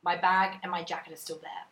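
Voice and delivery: ordinary talk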